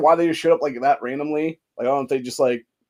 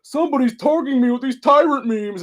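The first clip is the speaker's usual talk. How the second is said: much higher, put-on mocking voice; sarcastic high-pitched impression of a whining politician; mocking whiny victim; 12 semitones higher, 4 dB louder than usual. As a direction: squeak it out like a fake victim. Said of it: "and was like".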